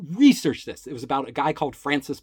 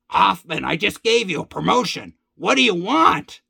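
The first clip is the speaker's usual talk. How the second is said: loud boss outburst, rising higher and slowing at the end; exasperated boss; 5 semitones higher, 6 dB louder than usual. Retold or imitated. Company head to a pushy employee just promoted, exasperated. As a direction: imitate a boss at the end of his patience.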